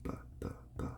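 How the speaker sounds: isolated b sounds, soft; sounding out b. Shown as a demonstration. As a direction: tap out "b" alone, soft and separate. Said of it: "You want to do the sounds".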